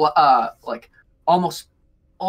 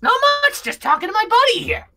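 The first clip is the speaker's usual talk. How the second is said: very high, excited little kobold voice; squeaky kobold Bug; 5 dB louder than usual. Acted. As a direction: pitch way up like a tiny cheerful goblin. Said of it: "he looks at you all and goes".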